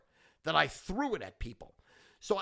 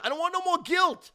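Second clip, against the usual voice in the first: much higher and louder, shouting it out; yelled rant; 14 semitones higher, 7 dB louder than usual. Real, not acted. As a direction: blow up on the word guilt. Peak of rant, repeated line.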